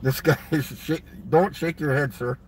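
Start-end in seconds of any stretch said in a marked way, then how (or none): none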